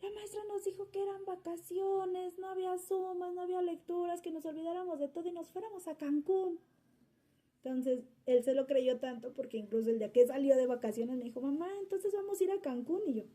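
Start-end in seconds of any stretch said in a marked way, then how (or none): 0.0-6.6 put-on high, tearful whine of a little boy; a hint of a sobbing boy complaining; imitating crying child. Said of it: "entonces empieza a llorar y dice". 11.4-13.0 put-on higher, eager little boy asking; child's voice. Son voiced asking about Cancún.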